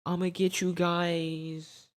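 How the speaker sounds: hammed-up mom yelling stop; mom chasing. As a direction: chase them in a high pleading mom voice. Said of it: "It's like".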